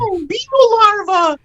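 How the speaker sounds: high-pitched pop star hype call. Acted. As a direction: coo it high like a pop diva. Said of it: "come out and be like".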